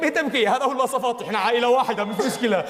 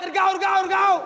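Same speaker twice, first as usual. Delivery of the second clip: shouting come back; 7 semitones higher than usual. Acted. Calling people back in scene.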